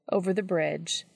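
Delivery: ordinary delivery, nothing marked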